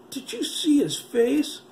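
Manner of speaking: put-on boy horrified by the dead face; horrified question. A narrator's reading. Slow, high horrified cry.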